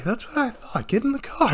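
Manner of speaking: high, hushed menacing father; a father catching his son swearing, hushed and stern. Retold or imitated. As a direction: quietly threaten like a shocked dad. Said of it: "when his father goes".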